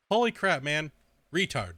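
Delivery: ordinary talk, nothing marked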